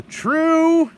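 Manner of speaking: loud, high exclamation; emphatic agreement. Real, not acted. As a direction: burst out agreeing loudly.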